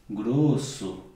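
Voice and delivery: grosso said low and slow; deep demo voice. Shown as a demonstration. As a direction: sink the pitch right down on grosso. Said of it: "o que é grosso". (grosso dito bem grave e lento)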